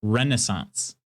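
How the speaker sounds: "Renaissance" as REN-uh-sonce, stress up front; correct pronunciation. Shown as a demonstration. Repeating the correction for chat, "Renaissance" stressed on REN. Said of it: "No, no, no".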